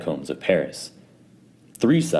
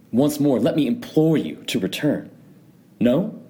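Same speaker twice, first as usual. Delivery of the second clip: higher, falsely pleading; mock implore; 8 semitones higher than usual. A narrator's reading. Montresor mockingly begging chained Fortunato to turn back.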